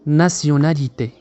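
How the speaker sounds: "nationalité" slowly but in one piece; measured, unbroken "nationalité" for learners; whole-word model. Shown as a demonstration. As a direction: join "nationalité" up as a single careful word. Teacher then says word whole.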